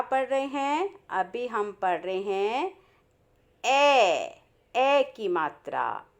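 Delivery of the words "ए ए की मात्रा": usual speaking voice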